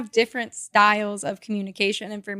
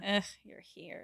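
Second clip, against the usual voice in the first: annoyed disgust; 12 dB quieter than usual. Shown as a demonstration. Cohost joins the demo with a disgusted, annoyed greeting. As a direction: groan it like they're unwelcome. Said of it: "totally different messages".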